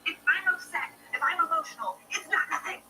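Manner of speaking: escalating huffy complaint; worked-up outburst. Acted. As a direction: customer insisting on a man, escalating indignant rant. Customer getting worked up.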